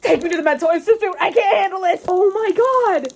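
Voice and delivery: loud, high-pitched frantic wail; frantic fangirl outburst. Real, not acted. Reader losing it over a book scene, frantic outburst. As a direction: blurt it out loud and high, overwhelmed.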